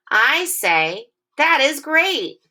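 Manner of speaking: slow, high, excited "That is great"; reading with expression. Shown as a demonstration. Teacher reading with the class, scooping for expression, excited. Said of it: "let's scoop for expression".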